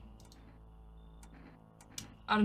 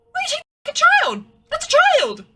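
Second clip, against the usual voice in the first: much higher, louder shout of protest; outraged shout; 17 dB louder than usual. Acted. A parent shouting at FEMA officials taking a child. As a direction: yell at them to stop taking the child.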